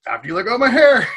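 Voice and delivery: loud, high retold retort; insistent shout. Bartender refusing to release the hair puller, insistent shout.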